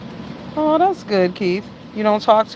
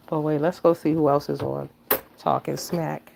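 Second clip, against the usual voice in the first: ordinary talk, nothing marked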